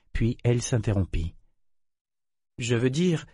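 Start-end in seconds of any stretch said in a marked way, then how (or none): 2.6-3.3 hesitant. A narrator's reading. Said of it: "poursuivit-elle hésitante".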